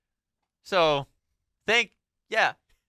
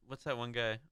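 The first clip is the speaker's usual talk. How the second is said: ordinary talk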